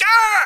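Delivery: sudden high, loud shriek of fright; startled scream. Retold or imitated. Woken by Brad in his face, shrieking in fright. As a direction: jolt awake screaming. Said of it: "and I'm like".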